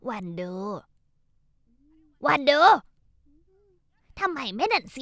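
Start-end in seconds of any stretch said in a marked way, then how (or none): 2.3-2.6 loud, higher call of a name; loud summoning call. Acted. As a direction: call her name across the house. Calling out to daughter-in-law.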